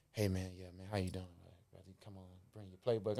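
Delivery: low, hushed retold summons; subdued summons. Voicing the man delivering bad news.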